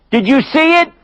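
high, sharp question; high probing question; father's pointed question. Acted. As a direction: press them on it.

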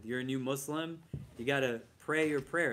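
ordinary talk

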